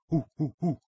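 short ape hoots; chimp hoots. Acted. Chimp answers Tara, hooting. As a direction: hoot like a chimp. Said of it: "Mister Harvey said".

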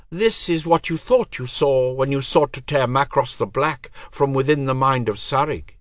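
high, cold divine voice; goddess voice. Acted. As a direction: speak as an aloof goddess.

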